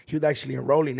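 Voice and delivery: ordinary talk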